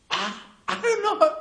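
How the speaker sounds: high-pitched, stammering helplessness; squeaky mock bewilderment; flustered pastor. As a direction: imitate a man totally at a loss. Pastor's clueless reply, acted.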